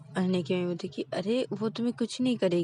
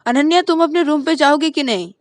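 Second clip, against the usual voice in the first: stern order; 8 semitones higher, 11 dB louder than usual. Acted. Mom orders.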